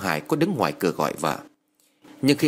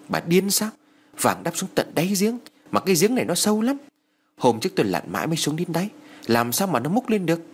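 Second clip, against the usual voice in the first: higher-pitched, mildly exasperated retort; irritated husband; 7 semitones higher than usual. A narrator's reading. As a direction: snap lightly at a worrying wife, pitched up. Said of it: "Ông Hải cau mày nói". (giọng chồng gắt nhẹ, cao giọng)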